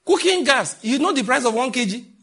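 high, loud, mocking disbelief; loud incredulous preaching. Real, not acted. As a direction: hammer the absurdity home loudly. Pastor mocks in raised voice.

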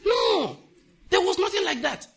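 high, loud outcry from the pulpit; emphatic denial. Real, not acted. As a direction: shout down the very idea. Rejects the imagined comfort.

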